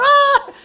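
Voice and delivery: sudden high piercing shriek; a loud, high, panicked yelp; scream like a girl. Retold or imitated. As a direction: shriek in mock terror on a galloping horse. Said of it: "I was screaming like a girl".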